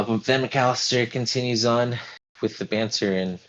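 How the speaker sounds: ordinary talk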